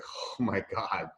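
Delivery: hushed, drawn-out imitation of a whisper; retold whisper. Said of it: "leaned over and whispered to me".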